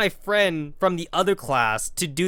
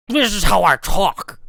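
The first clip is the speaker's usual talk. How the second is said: louder, hoarse teacher voice; Doctor Humphrey's croak; 6 dB louder than usual. Retold or imitated. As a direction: croak back, offended. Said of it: "you don't sound good".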